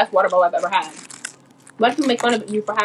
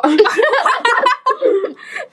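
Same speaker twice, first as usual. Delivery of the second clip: high burst of laughter; 14 semitones higher than usual. Real, not acted. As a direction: shriek with laughter.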